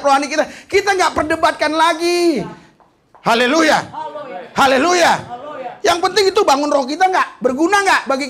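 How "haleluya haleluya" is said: loud, ringing hallelujah; preacher's shouted hallelujah. Real, not acted. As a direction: call it out to the hall. Preacher calling out to congregation.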